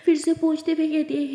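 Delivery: ordinary delivery, nothing marked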